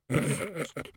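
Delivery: short, soft stifled snigger; muffled snigger. A narrator's reading. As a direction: snigger under your breath. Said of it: "there was a muffled snigger".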